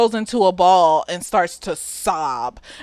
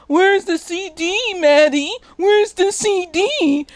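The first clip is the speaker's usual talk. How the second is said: slow, raised-pitch demanding questions; mock interrogation; 9 semitones higher, slower than usual. Nate confronting Maddie over the disc, mock interrogation. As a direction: draw out each question, pitched up.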